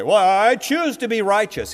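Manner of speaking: lightly voiced eager chooser, pitched high; eager volunteer. Voices listener making a choice.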